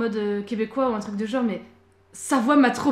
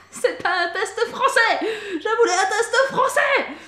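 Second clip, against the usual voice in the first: laughing; 8 semitones higher, 5 dB louder, slower than usual. Real, not acted. Laughing protest about the quiz.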